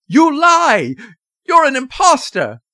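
loud, very high furious cry; outraged father shouting; angry accusation. A narrator's reading. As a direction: hurl the accusation frantically. Frantic father accuses old man.

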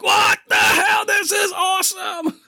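high, slow, shouted excitement; thrilled shout. Retold or imitated. Kid cheering the movie battle, high slow thrilled shout. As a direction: yell it like an ecstatic kid.

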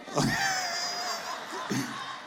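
sudden high-pitched burst of laughter. Real, not acted. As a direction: crack up at your own story. Pastor bursts out laughing at story. (carcajada aguda y repentina)

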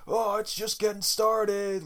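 slow, high, lightly voiced desperate drunk guy; desperate Kirk. Desperate Kirk trying to keep the party going, slow high whine. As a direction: ham up a sad party-goer drawing it out. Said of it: "sober, desperate Kirk".

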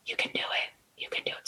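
soft encouraging whisper. Shown as a demonstration. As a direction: coax gently in a whisper. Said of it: "guiding listener to meditate".

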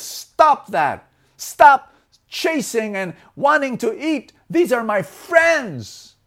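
high, loud command; standing one's ground. Acted. Elephant defends its friends from the tiger, loud firm command. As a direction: order them off, stretching out the final declaration. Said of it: "stood its ground and says".

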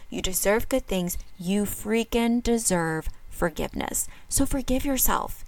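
usual speaking voice

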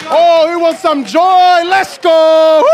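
high, loud, slow shouting; rally the whole room; joyful shout. Real, not acted. Shouting to crowd.

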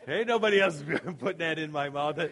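words broken by real laughter; laughing while joking about the toothbrush; laughing through it. Real, not acted. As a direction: chuckle through the punchline.

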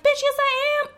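high sassy retort; sassy comeback. Pretend. Clerk's imagined retort to the friends, high and sassy. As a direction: fire it back sassy. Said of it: "And I was like".

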